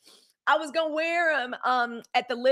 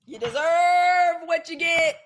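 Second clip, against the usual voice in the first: slow, higher, louder shout; emphatic shout; 4 semitones higher, 5 dB louder, slower than usual. Real, not acted. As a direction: hammer each word home. Ranting at Caitlyn Jenner.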